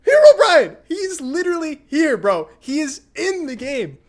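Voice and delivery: very high, loud yell for cousin; frantic mock shout. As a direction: yell the terrifying news at your cousin. Retold frantic call to cousin.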